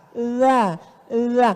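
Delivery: za with tongue tip on the upper teeth; Arabic za. Shown as a demonstration. Preacher teaching the crowd the za sound. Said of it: "Letakkan ujung lidah di atas gigi".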